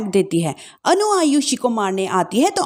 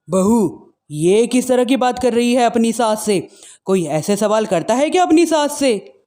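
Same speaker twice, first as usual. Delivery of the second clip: wicked stepmother. Acted. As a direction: put the bride in her place. Mother-in-law rebukes bride.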